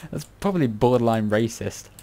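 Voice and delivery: ordinary delivery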